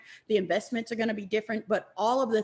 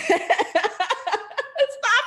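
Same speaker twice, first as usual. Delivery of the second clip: high laughing fit; real overwhelmed laughter; helpless giggling; 10 semitones higher, 4 dB louder than usual. Real, not acted. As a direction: laugh helplessly through happy tears. Laughing while tearing up.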